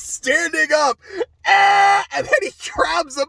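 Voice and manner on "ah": loud high anguished yell; imitated yell. Imitating a friend's outburst during the finale, a loud high yell. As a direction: belt out a friend's pained yell. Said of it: "I made that exact same noise".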